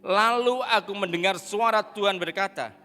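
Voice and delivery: slow, loud reading aloud to the church; proclaimed Bible reading; loud scripture reading. Acted. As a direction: read it out together, slow and strong. Congregation reads scripture aloud.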